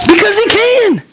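high, emphatic wonder; marvelling emphasis. Real, not acted. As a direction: lift it high in amazement. Marvelling repeat about God's sunset.

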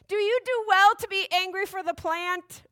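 high, petulant character voice; Jonah's voice. Acted. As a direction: read it as an indignant sulker. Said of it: "wrong voice".

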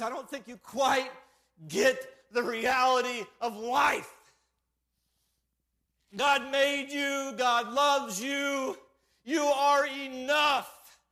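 slow, loud, high preacher's proclamation; impassioned, drawn-out pulpit delivery; full-force preaching. Real, not acted. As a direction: proclaim it to every soul in the room.

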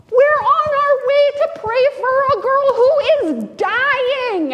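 high, loud anxious protest; urgent disciples. Acted. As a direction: push him to hurry, panicking. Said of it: "his disciples were like".

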